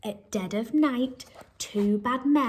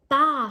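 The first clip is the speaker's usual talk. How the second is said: louder bleating baa; sheep baa; 4 semitones higher, 6 dB louder than usual. Acted. Teacher reading a farm story, a mock sheep baa.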